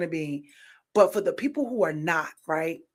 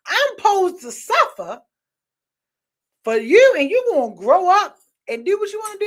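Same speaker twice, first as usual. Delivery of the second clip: indignant; 8 semitones higher, 6 dB louder than usual. Real, not acted. Indignant rant at the father.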